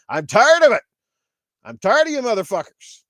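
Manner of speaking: fed-up shout. Real, not acted. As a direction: blow up with real frustration. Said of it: "I am riled the fuck up tonight".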